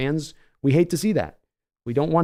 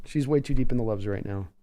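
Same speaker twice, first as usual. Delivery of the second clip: normal talking voice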